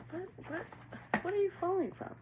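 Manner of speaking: high-pitched incredulous questioning; irritated bafflement. Real, not acted. Reader asks what they are falling from, baffled. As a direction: squeak out your confusion. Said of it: "I don't know why I'm getting so irritated".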